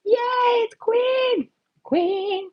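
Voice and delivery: high-pitched squeal of greeting; excited cheer. Real, not acted. Streamer spots a favorite viewer arriving, excited cheer.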